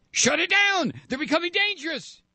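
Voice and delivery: very high mock shriek; mock screaming. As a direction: throw their screaming back in a high, mocking voice. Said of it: "you heard the screaming and the shrieking".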